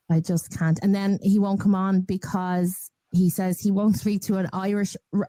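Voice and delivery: ordinary talk, nothing marked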